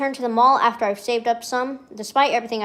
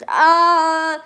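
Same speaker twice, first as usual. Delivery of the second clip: long, high groan of frustration; loud exasperated groan; 7 semitones higher, 8 dB louder than usual. Real, not acted. Streamer overwhelmed by forgetting a birthday, groaning.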